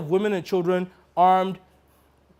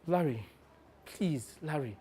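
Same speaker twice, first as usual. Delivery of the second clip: quiet plea; 9 dB quieter than usual. Real, not acted. Pleading quietly after outburst.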